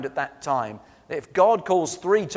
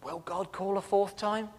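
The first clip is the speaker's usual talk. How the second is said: soft, higher, wondering to himself; doubting inner thought; 4 semitones higher, 7 dB quieter than usual. Acted. Eli privately wondering about another call, doubting thought. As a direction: ask it quietly, as a doubtful thought. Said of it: "Eli thought".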